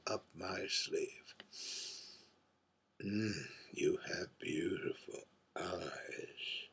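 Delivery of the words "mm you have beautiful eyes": slow breathy whisper, lower; soft whisper. Acted. Whispering to the bright young man, slow and soft. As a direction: lean in and whisper the compliment slowly. Intimate compliment to listener.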